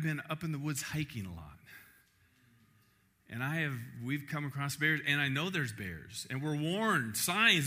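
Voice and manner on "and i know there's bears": ordinary talk, nothing marked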